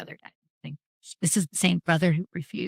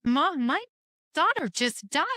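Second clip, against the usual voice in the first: higher-pitched, pleading protest to mom; retold exasperated retort; 6 semitones higher, slower than usual. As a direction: quote yourself pleading, voice lifted.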